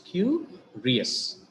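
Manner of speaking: "curious" in two parts, a high "cu", then "rious"; split into syllables. Shown as a demonstration. Teacher counting two syllables, "curious" split as cu, rious. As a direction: break "curious" after "cu" with a pause. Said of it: "we have got two syllables".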